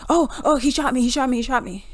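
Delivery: mock whiny cop. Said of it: "And then you're like".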